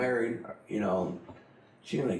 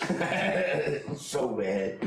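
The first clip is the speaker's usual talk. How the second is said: laughing drawn out; 7 semitones higher, 4 dB louder, much slower than usual. Real, not acted. Laughing at gross memory.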